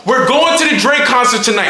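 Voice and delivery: loud, high burst of big news; hyped-up retold announcement; excited announcement. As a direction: blurt out the huge news. Retelling friend's big concert news.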